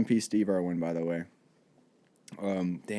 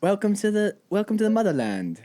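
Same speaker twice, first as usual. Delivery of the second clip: higher-pitched mock Australian welcome; hammed-up amateur Australian; badly faked Australian, playing a suitor; Australian accent; 7 semitones higher than usual. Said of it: "and I'm from Australia".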